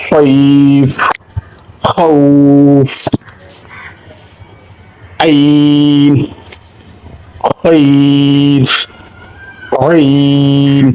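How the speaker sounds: খাউফ and খৈর drawn out very slowly, last letter silent; recited stops. Shown as a demonstration. Teacher reciting examples to students: খাউফ drawn out very slowly. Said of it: "সাকিনের মতো উচ্চারণ করা হলো".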